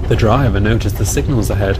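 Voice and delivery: usual speaking voice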